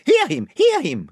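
high, eager cheering-on; chorus of followers. A narrator's reading. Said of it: "came the chorus".

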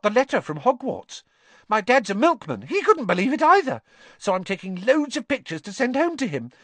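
high, excited schoolboy gushing; breathless excitement. A narrator's reading. Colin telling Harry about magic and his dad, thrilled. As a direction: gush excitedly in a small boy's voice. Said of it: "great shuddering breath of excitement".

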